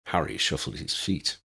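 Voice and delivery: plain reading voice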